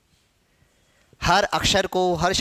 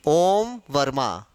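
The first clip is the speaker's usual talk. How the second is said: ओम Verma said slowly; slow careful reading; 5 semitones lower, much slower than usual. Shown as a demonstration. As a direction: read the name out slowly, word by word. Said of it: "तो हम कैसा लिखेगा?". (धीरे-धीरे नाम पढ़ना)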